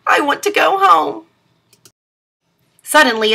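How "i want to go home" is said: high, pleading turtle cry; a small turtle crying to go home; lightly sobbing, lost and homesick; tearful cry. A narrator's reading. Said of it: "cried big, fat turtle tears and cried".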